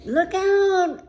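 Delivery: sudden high, loud cry; shouted warning. A narrator's reading. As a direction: yell it out as a warning. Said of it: "they shouted".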